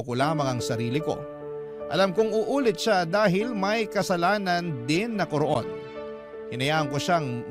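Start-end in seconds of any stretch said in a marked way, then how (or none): none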